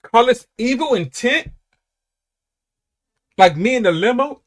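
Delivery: incredulous. Acted. Quoting lyric in disbelief.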